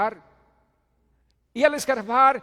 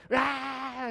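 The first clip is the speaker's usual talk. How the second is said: mock baby cry; 9 semitones higher, 4 dB louder than usual. Imitating baby's cry.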